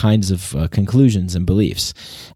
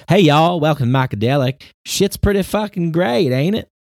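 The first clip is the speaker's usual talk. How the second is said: overjoyed podcast intro, pitched way up; positivity bubble guy; 7 semitones higher than usual. Pretend. Podcaster parodying a blissed-out host, high and cheery. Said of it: "tongue-in-cheek poke at people who live in, like, a positivity bubble".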